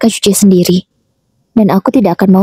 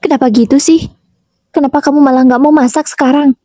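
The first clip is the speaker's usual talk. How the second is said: higher, sharp questioning of the daughter-in-law; raised-voice mother-in-law, mildly voiced; high-pitched demand; 6 semitones higher than usual. A narrator's reading. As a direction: raise the pitch and press her for an answer. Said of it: "dengan nada tinggi".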